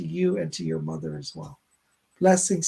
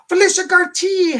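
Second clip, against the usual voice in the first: excited name call; 9 semitones higher, 9 dB louder than usual. Real, not acted. Calling out the next viewer.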